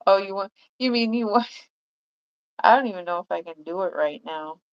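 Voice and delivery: ordinary talk, nothing marked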